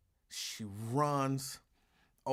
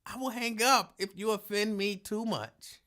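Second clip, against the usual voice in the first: ordinary talk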